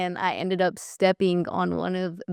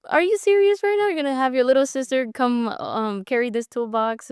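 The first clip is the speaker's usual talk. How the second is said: mock outrage; 5 semitones higher than usual. Sister mocking her outrage at brother Mario's toolbox order. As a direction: act out being fed up with your brother. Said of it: "and I'm like".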